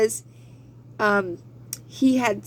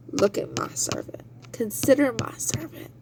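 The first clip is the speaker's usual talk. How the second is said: whispered, softer God boast; 5 dB quieter than usual. Acted. God's boast to Satan, now whispered. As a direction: breathe it like a secret.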